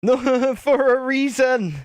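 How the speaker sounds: high, drawn-out protest through laughter; real laugh breaking into a high protest. Real, not acted. Laughing protest at being teased.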